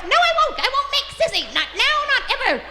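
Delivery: very high, loud comic dog voice; squeaky, emphatic canine tantrum; defiant talking dog. Acted. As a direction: refuse like a stubborn little dog. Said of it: "And the dog goes".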